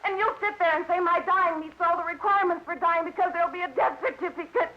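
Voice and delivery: high, desperate voice pouring out; distraught outburst. Acted. Daughter tells detective she is being poisoned, distraught and accusing. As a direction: build a frantic accusation, pitched high throughout.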